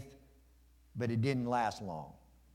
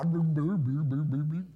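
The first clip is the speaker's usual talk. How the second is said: bubbling "boo-boo-boo" noise; imitated sinking noise; 2 semitones higher than usual. Preacher tries walking on water and sinks, imitated bubbling. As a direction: babble it like going under.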